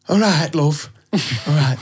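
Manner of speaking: put-on light northern English accent; a breezy northern English greeting. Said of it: "like, a northern accent".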